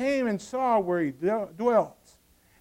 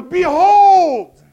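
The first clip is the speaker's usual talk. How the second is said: very loud, high, long shout; sudden yell; 8 semitones higher, 13 dB louder than usual. Acted. John the Baptist suddenly yelling as you sit beside him, shout. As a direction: burst out with a full yell. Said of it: "he yells".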